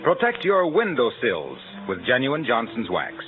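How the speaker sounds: ordinary delivery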